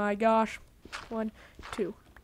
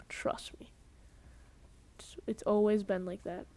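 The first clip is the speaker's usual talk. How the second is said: whisper; 2 semitones lower than usual. Acted. Conspiratorial aside to viewers.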